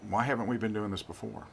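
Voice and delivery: normal talking voice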